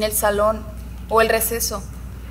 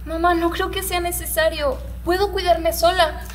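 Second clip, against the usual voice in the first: put-on higher, protesting child to her mother; pleading young daughter; 5 semitones higher than usual. Twelve-year-old telling mum she can cope alone, pleading. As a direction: play a girl insisting to her mum.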